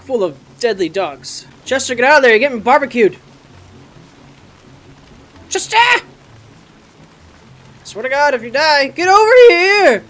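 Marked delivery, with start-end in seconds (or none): 1.6-3.2 loud urgent call to the pet. Real, not acted. Player calls pet Chester from flames, loud urgent call. 5.5-10.0 building to a high, loud yell; angry shout. Real, not acted. Owner yells pet Chester over, rising to a shout.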